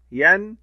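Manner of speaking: ين said as a short loud "an" ending; tanween sound. Shown as a demonstration. Teacher demonstrating fatha tanween to pupils, loud and clear. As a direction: sound out ين as the nunation "-an". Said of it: "تنوين بالفتح".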